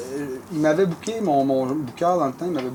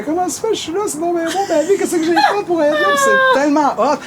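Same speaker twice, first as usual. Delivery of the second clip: high, cracking voice of happy tears; half-crying in wonder; tearful joy; 11 semitones higher, 3 dB louder than usual. Retold or imitated. Singer on the crab boat at dawn, voice breaking with happy tears. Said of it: "je pleure ma vie".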